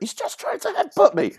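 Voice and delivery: very high Welsh mock scream. Actress squealing at the near headbutt, high Welsh voice. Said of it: "she screamed out in that Welsh voice".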